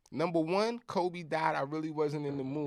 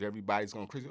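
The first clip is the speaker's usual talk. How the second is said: normal talking voice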